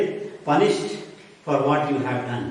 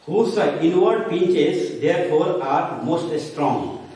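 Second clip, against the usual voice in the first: higher and very slow, drawn-out verse; dramatic recitation; 3 semitones higher, slower than usual. Shown as a demonstration. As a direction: stretch each word like a solemn actor. Teacher declaims Shakespeare quote.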